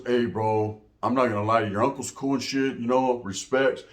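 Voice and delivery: low, restrained mock annoyance; retold mad friend. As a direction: imitate a fed-up friend keeping it respectful. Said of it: "all mad".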